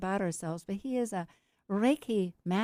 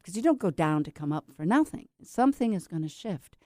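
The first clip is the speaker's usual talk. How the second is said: ordinary talk, nothing marked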